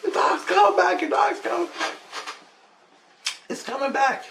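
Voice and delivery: very high excited shouting; kids yelling. Retold or imitated. Kids shouting to their dad that the dog returns, very high and excited. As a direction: yell the news like excited children. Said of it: "The kids are yelling".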